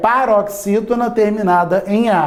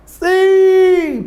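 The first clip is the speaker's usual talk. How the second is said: triumphant teacher's loud yes; emphatic high yes; 11 semitones higher, 5 dB louder than usual. Real, not acted. Teacher answers own quiz question.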